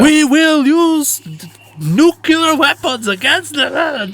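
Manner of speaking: high, slow yell in a hammed-up German accent; mock German accent. Soviet diplomat yelling at the American, mock German accent. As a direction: bellow it slowly, high, in a bad German accent. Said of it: "I'm doing a German accent".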